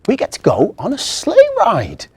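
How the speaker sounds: put-on high, loud eager boy; bursting with excitement; thrilled boy. William is thrilled about a sleigh ride, high and loud.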